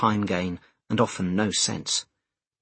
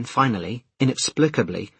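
usual speaking voice

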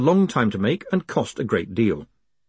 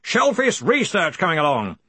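high, hearty raised voice; yelled question; 7 semitones higher than usual. Acted. Arch-Chancellor repeating his assistant's prompt, hearty yell. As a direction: call the question out over distance. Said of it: "yelled Ridcully".

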